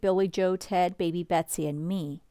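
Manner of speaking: ordinary delivery, nothing marked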